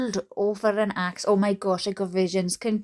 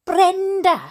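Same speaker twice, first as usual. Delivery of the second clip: high, louder call of a name; narrated shout; 9 semitones higher, 5 dB louder than usual. A narrator's reading. Grace calling Brenda five minutes later, shouting. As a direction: call out to her, pitched well up. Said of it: "shouted Grace five minutes later".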